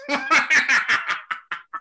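gleeful villain cackle; maniacal laugh. Acted. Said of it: "I'm laughing maniacally".

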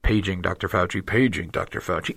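low, flat hospital intercom page; flat echoey PA page. Pretend. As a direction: call it out like a hospital loudspeaker. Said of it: "we hear something that goes like this".

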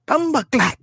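high-pitched mock Jamaican squawk; Jamaican accent. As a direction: squawk it in exaggerated patois. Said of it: "talking that Jamaican accent".

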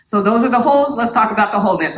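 ordinary talk, nothing marked

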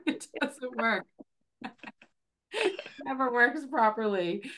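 slow words breaking into laughter; real laughter through the words; laughing. Real, not acted. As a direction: laugh helplessly as you admit it. Laughs at failing to hold back.

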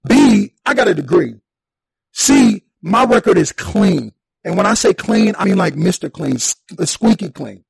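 loud, listing why it's unfair; indignant rant. Retold or imitated. Man ranting at cops who wrongly arrested him, loud indignant. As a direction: tick off every point, fed up. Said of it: "That's going through my head".

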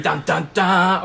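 three-note "dun, dun, dun", last one drawn out; dramatic sting. Shown as a demonstration. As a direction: voice a mock suspense jingle. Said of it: "sometimes it's gonna be".

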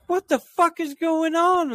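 high, drawn-out yell of alarm; retold yell. Running up to the barn, yelling at the chaos. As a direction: shout it high and slow, half in alarm.

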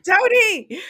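high, loud reproachful call of his name; scolding shout. Retold or imitated. Scolding Tony while watching.

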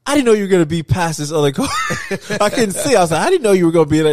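high, loud, worked-up storytelling; excited retelling. Real, not acted. Driver telling a friend about being pulled over, excited and loud. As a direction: tell it all riled up, voice up high.

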